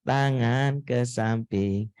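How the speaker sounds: put-on slow, bright sing-song instructions to small kids; kindergarten teacher. Shown as a demonstration. Preacher demoing how to talk to kindergarteners, slow sing-song. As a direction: lead a TK class clapping game, slowly. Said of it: "pakai gerakan tepuk-tepuk".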